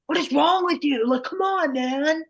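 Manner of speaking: put-on sideline parent barking at a kid; yelling sports parent. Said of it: "when parents are like".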